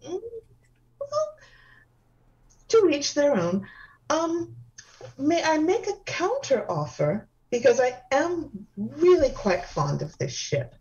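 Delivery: high, airy, dainty hostess; delicate femme voice. Acted. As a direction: gush sweetly like a dainty socialite. Same dainty character.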